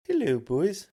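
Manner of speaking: high, loud mock Canadian greeting; hammed-up Canadian hoser calling out; weird Canadian accent. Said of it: "That was a weird accent".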